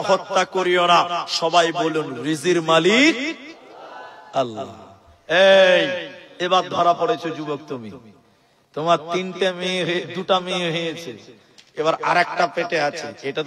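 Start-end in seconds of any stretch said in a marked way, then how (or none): none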